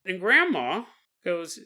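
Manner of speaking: ordinary delivery, nothing marked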